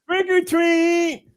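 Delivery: very high, drawn-out cleft-palate imitation; hare-lipped little boy. Little boy at the old lady's door, hare-lip voice. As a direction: squeal it slowly as a hare-lipped kid. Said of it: "I'm gonna tell the joke in hair lip".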